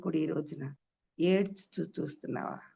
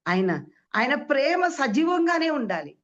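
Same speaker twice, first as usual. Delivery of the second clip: higher and louder declaration; fervent proclamation; 7 semitones higher, 5 dB louder than usual. Acted. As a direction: proclaim it with fire. Preacher proclaims. (ఉత్సాహంగా ప్రకటించడం)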